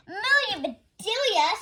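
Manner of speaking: loud, high exasperated shout of her name. A narrator's reading. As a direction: call her out in alarm. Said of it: "shouted Mister Rogers".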